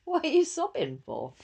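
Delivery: high, puzzled voice; puzzled daughter. Retold or imitated. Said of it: "she'll probably be looking at me like".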